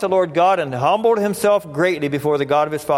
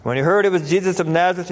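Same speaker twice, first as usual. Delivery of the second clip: normal talking voice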